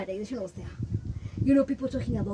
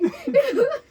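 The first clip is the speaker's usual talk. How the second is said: high loud laugh; 7 dB louder than usual. Real, not acted. Laughs at the painful memory.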